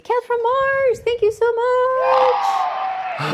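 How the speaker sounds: high, drawn-out excited shout of thanks. Real, not acted. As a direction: call it out big and slow to the fan. Calling out thanks to viewer.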